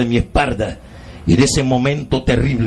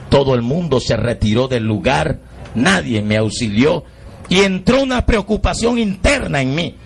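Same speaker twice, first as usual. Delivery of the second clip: normal talking voice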